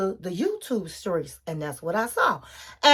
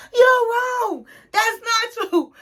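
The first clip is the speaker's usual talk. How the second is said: upset husband; 10 semitones higher, 3 dB louder, slower than usual. Retold or imitated. Husband denies, getting upset.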